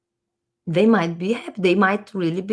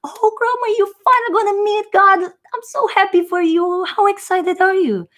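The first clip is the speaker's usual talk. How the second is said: much higher, gushing cheer at the bedside; family around the hospital bed, overjoyed for grandma; happy relatives; 11 semitones higher than usual. Pretend. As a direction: coo it to grandma like a glad farewell. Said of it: "they're all happy ... happy, like".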